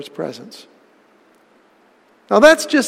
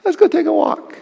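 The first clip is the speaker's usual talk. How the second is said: high, falsely friendly invitation; mock-innocent high voice of Cain; scheming Cain; 12 semitones higher than usual. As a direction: lure him out sweetly with a high voice. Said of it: "he says to his brother Abel".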